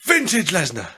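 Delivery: high, punched announcer catchphrase; mock wrestling commentator. Co-host joining the commentary game, high mock-announcer call. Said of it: "Gotta be better than Michael Cole".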